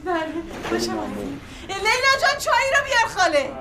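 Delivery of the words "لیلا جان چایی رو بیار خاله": higher, pressing second call toward the kitchen; calling out again, more insistent. Acted. Aunt calling the niece a second time for tea, more insistent. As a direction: call her name again, impatient now.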